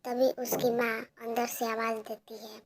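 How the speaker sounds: plain reading voice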